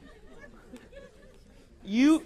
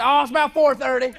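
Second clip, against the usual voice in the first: loud ridiculous answer; 7 dB louder than usual. Retold or imitated. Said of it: "throw back to her something as ridiculous".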